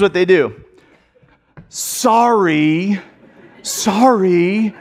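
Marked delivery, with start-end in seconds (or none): 1.7-4.7 drawn-out grudging "sorry"; insincere apology. Retold or imitated. Child made to apologize to a sibling, grudging. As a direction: mimic a kid forced to apologize. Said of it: "Same words, right? Different tones".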